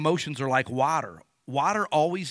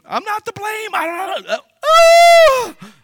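put-on much higher, loud crying protest of a child; whining child; 16 dB louder, slower than usual. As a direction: wail like a kid sent to his room. Child protesting being sent away.

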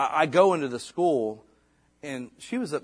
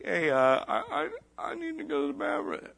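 put-on timid little girl; 12 semitones higher than usual. Daughter voiced asking for bathroom.

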